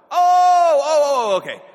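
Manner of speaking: gushing surprised receptionist; sudden recognition. Retold or imitated. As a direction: squeal it out as the penny drops. Receptionist realizes who he is.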